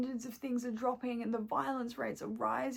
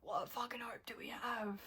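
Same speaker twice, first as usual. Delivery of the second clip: whispered and much softer, hopeless; despairing whisper; 11 dB quieter than usual. Real, not acted. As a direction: let it drop to a whisper of despair. Despairing over the world.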